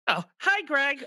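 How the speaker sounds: high, put-on greeting; high-pitched character hello; second character's voice. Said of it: "I'm both characters".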